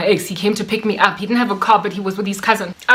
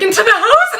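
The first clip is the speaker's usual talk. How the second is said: words rising into high laughter; real helpless laugh at the end; breaking into laughter; 12 semitones higher than usual. Real, not acted. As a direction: crack up at your own embarrassment. Cracks up at her own humiliation.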